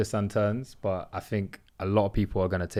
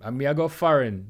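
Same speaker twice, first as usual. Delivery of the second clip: imitated Jamaican accent, slightly higher; 4 semitones higher than usual. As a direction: do your best Jamaican patois voice. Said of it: "That's my best Jamaican accent".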